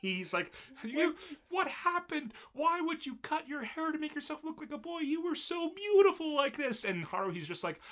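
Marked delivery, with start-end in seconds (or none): 0.8-6.8 distraught wail. Retold or imitated. King confronts her about cutting her hair, hammed-up high wail. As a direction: wail it in a squeaky, over-the-top falsetto. Voicing King's upset over haircut.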